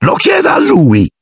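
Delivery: high accusing shriek; shrill accusing cry. Acted. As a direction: point and shriek at the boy. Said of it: "strillò Filch".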